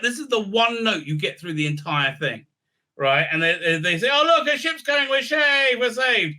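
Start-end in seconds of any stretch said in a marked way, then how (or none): 4.1-6.3 high, hammed-up cry of rescue; mock excited relief. Survivors think a ship is coming, mock excited cheering. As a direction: cheer like a castaway spotting help.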